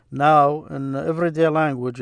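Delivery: usual speaking voice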